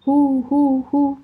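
high, loud hunting cry; shouting. A narrator's reading. Jules shouts to his dogs, echoing loud call. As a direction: let the call ring through the mountains. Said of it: "ژول فریاد کشید".

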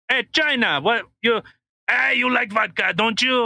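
high-pitched, wheedling Russian caricature; mock Russian accent. Russia offering China vodka, mock Russian accent. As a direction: pitch it up as a hammed-up Russian salesman.

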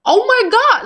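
high, loud cry of relief; overwhelmed joy. Retold or imitated. Hearing the doctor say she's protected, overwhelmed high cry. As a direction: burst out in disbelief at the news. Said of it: "I just, like, started crying".

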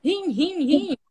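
a dad who can only say one word; imitating a frantic father; frantic repeating. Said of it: "só sabia falar uma coisa".

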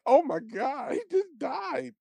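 very high, hushed disbelief; retold shock. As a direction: act out stunned horror in a small high voice. Retelling own shocked reaction.

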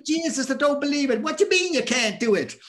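high, loud Irish exasperation; Irish dad impression. Dad scolds son who says he can't box, loud Irish accent. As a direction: imitate an Irish father in disbelief. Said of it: "And my dad's Irish".

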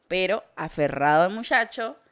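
normal talking voice

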